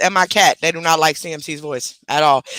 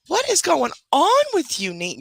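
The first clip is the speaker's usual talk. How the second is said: much higher sing-song puppy talk; pet talk voice; 10 semitones higher than usual. Real, not acted. Talking to her dog.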